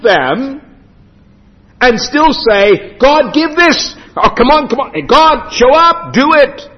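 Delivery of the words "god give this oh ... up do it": higher-pitched, impatient coaxing; imitated demanding plea. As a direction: mimic someone pestering God for help. Voices sinners demanding God's help.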